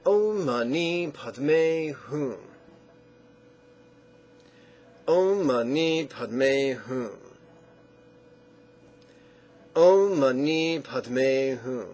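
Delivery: "Om Mani Padme Hum" very slow and evenly drawn out; syllable stacking. Shown as a demonstration. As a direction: recite "Om Mani Padme Hum" as a deliberate drill for echoing. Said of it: "And now six syllables".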